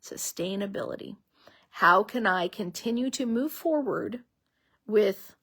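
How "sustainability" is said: softly repeated word. Real, not acted.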